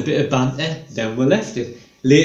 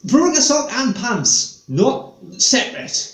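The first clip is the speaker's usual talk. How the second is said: pedantic explaining; 7 semitones higher than usual. Retold or imitated. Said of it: "He said".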